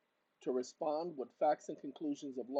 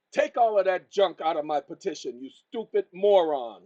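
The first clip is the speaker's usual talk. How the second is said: loud, angry scolding; raised voice; 3 semitones higher, 13 dB louder than usual. Real, not acted. Berating his phone assistant for padding the petition, furious yelling. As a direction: yell at it to strip the junk out. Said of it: "so he can hear me raise my voice".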